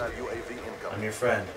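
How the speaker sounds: slow, low and soft; hushed, playful appeasing; mock-meek plea. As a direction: draw it out quietly, coaxing the attacker. Pleads with approaching enemy player.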